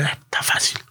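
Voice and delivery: whispered aside to himself; hushed, cocky little whisper. Retold or imitated. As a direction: breathe it out, no voice, confident. Thinking to himself before stealing.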